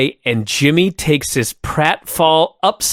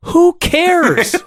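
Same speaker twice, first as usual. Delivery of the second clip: exasperated. Retold or imitated. Mock exasperated retort.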